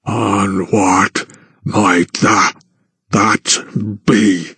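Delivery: slow careful rumble; giant Byrne asking. A narrator's reading. Byrne asks the count, slow deep voice. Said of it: "asked Byrne".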